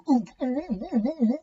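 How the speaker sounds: overdone repeated oof grunts, rising; dramatic oof. A narrator's reading. Daniel making dramatic oof noises to make us laugh. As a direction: puff out theatrical oofs. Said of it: "this dramatic oof noise".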